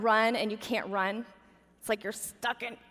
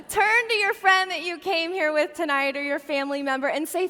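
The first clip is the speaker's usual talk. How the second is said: ordinary talk, nothing marked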